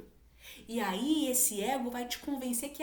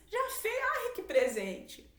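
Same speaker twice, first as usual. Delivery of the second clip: delighted mom; 12 semitones higher, slower than usual. Retold or imitated. Mother praising child.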